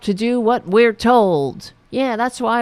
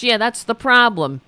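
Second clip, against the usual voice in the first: ordinary talk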